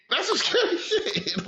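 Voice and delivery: That's some scary shit laughed out high and slow; high, slow laughing repeat. Real, not acted.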